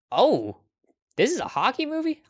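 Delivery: the question jumps way up in pitch, pleasantly amazed; surprised. Real, not acted. Discovers a hockey movie.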